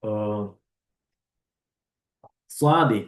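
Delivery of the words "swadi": "swadi" spoken out loud as a clear ts sound. Shown as a demonstration. Teacher sounding out "swadi" to the class. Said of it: "Jadi huruf swadi".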